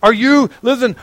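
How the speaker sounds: normal talking voice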